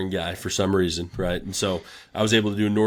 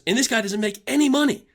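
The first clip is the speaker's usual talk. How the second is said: high, pitched-up imitation of a furious coach; angry coach rant. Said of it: "he was pissed off at the team".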